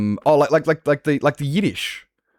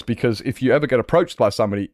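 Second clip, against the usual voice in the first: ordinary delivery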